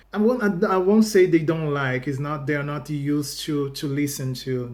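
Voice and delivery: ordinary talk, nothing marked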